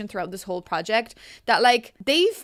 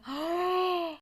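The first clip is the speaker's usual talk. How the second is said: rising delighted gasp, close to tears; awed gasp; 8 semitones higher, 5 dB quieter than usual. Real, not acted. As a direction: gasp as if about to cry from joy. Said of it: "I also want to cry once again".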